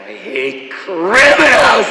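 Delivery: over-emphatic, slow, loud actor impression; Kirk Douglas. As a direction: build to a drawn-out, high shout. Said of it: "like, Kirk Douglas in it".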